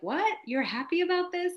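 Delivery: high, disbelieving question; surprised boss. Retold or imitated. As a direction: sound shocked and incredulous. Boss voiced in a retold scene.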